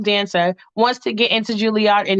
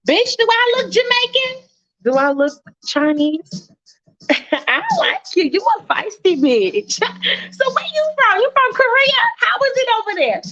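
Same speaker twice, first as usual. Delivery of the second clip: much higher, sassy roommate voice with laughs; 10 semitones higher than usual. Pretend. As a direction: play the feisty roommate, laughing at her. Roommate voiced in game scene.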